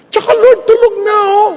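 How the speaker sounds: high, pleading whine; husband whining to God; whiny excuse. Retold or imitated. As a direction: beg off with an excuse.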